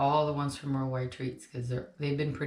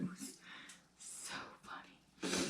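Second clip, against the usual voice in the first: whisper; 16 dB quieter, much slower than usual. Real, not acted. Laughing aside after story.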